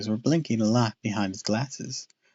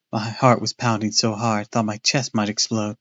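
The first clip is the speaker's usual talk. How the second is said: plain reading voice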